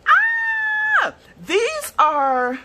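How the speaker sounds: very high, loud squeal of joy; delighted scream. Real, not acted. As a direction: shriek with delight. Shout of excitement.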